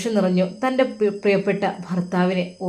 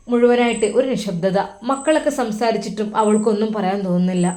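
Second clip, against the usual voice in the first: ordinary delivery, nothing marked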